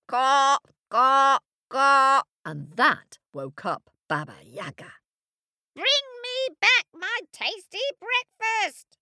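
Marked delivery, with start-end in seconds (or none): 0.1-2.2 loud cawing birds; crow caw. A narrator's reading. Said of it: "The birds crowed". 5.7-9.0 high shrieking witch. A narrator's reading. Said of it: "shrieked Baba Yaga".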